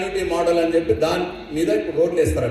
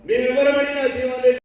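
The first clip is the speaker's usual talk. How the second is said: defiant loud challenge; 3 semitones higher, 5 dB louder than usual. Real, not acted. Defiant challenge to chief minister.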